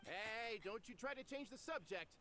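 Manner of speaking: ordinary talk, nothing marked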